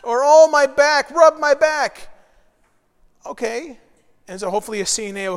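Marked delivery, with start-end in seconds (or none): 0.2-2.1 much louder, higher whiny plea; demanding old lady. Retold or imitated. Preacher mimics a resident at service, loud mock plea. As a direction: call out for a back rub. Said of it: "they're just saying".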